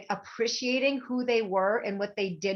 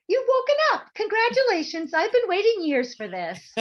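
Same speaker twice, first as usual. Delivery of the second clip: high, loud, delighted congratulation; high cheerful psychic; 13 semitones higher, 7 dB louder than usual. Retold or imitated. The psychic congratulating her, high and delighted. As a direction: sing it out brightly like a thrilled mentor. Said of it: "she says".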